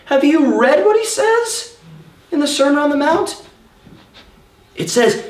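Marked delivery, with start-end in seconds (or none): 0.1-3.4 incredulous challenge. Retold or imitated. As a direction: stretch it out, high and amazed. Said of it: "I thought".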